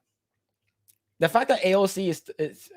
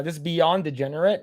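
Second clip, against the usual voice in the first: ordinary talk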